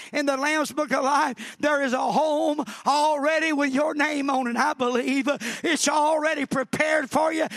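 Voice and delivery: insistent revival preaching; fervent preaching. Real, not acted. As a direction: preach it hard, building to a high cry. Preaching at full force.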